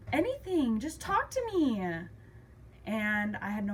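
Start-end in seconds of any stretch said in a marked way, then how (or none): 0.1-1.8 high, loud, drawn-out demand; exasperated plea. Retold or imitated. Daughter begging dad to talk to her, exasperated. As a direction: plead loudly, stretching the words out slowly.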